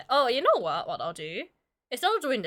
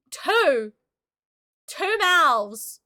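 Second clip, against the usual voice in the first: louder, higher amazed cry; excited exclaim; 5 semitones higher, 4 dB louder, much slower than usual. Real, not acted. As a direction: exclaim like it's a wonder. Excited exclamation over model.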